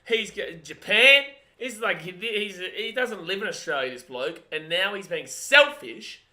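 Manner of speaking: loud, high-pitched tirade; exasperated rant. Real, not acted. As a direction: punch out the complaints loudly. Ranting about a friend's travels.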